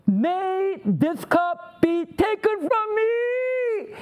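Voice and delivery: high, slow, drawn-out plea; acted agonized prayer; anguished plea. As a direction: cry out to the Father, stretching each word. Acting Jesus pleading in Gethsemane.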